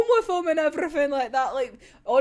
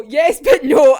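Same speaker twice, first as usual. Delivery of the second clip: "yes but no" blurted much louder; 11 dB louder than usual. Real, not acted. Torn reaction to manga reveal.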